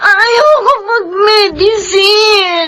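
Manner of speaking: mabagal at humihikbing pagtanggi; umiiyak na pagtanggi. Acted. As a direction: itulak ang salita sa gitna ng iyak. Daughter refuses medicine, crying. (humihikbing pagtanggi sa daddy)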